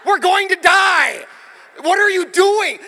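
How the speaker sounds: high, full-volume yelling to wake someone; frantic shout. Retold or imitated. Yelling at a sleeping driver to wake up, frantic shout. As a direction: scream it in alarm, far above talking pitch.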